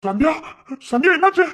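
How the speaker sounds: husband shouting for his wife after a noise; alarmed calling. Acted. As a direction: call out, fearing she is hurt.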